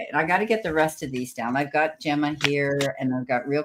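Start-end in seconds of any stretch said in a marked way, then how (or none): none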